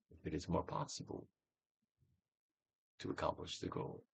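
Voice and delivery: breathy whispered prompt; whispering the question to the listener. Acted. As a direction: drop to a hushed whisper. Whispered aside in guided exercise.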